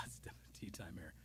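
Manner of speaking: a quick unvoiced whisper of tea time; whispered aside. Real, not acted. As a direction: drop to a whisper, as if to yourself.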